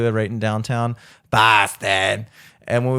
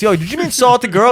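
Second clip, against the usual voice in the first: teasing friend voice; 11 semitones higher, 7 dB louder than usual. Retold or imitated. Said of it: "they're like".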